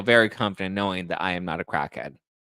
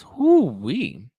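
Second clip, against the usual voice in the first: mock amazed whoop; 5 semitones higher, 6 dB louder than usual. Real, not acted. Exclaiming at wild news.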